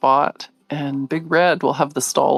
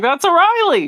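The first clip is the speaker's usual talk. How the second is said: insistent excitement; 12 semitones higher, 4 dB louder than usual. Retold or imitated. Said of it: "I was like".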